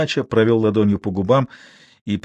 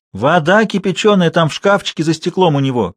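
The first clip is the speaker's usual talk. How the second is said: higher, louder call from the kitchen; 7 semitones higher, 4 dB louder than usual. A narrator's reading. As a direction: shout it from the other room. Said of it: "крикнула из кухни". (крикнув из кухни)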